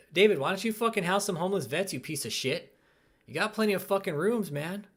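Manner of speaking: ordinary talk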